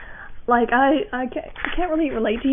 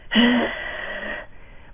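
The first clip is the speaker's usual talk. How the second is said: breathy exhale; 4 dB quieter than usual. Shown as a demonstration. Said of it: "you're breathing out like".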